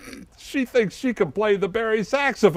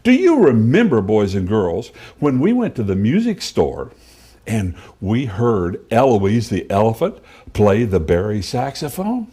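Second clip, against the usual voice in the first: put-on kids show host; 5 dB louder than usual.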